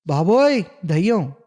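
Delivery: a sharp, high yelp of fright; terrified cry. A narrator's reading. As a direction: shout it like he's seen a ghost. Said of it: "అని అరిచి వెనక్కి పడిపోయాను".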